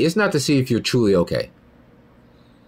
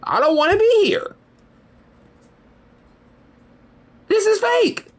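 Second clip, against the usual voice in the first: high squeaky cartoon soul voice, much higher; inner soul voice. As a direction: play your own bored inner soul whining to leave. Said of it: "My soul was like".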